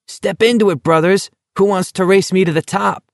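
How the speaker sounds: high, cheerful taunting call; merry call. A narrator's reading. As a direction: call out gleefully up the hill. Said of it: "called out merrily".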